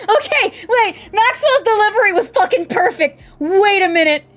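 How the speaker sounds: high, loud, laughing exclamation; tickled, overexcited outburst; cracking up. Real, not acted. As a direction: burst out in laughing delight. Cracking up at game line delivery.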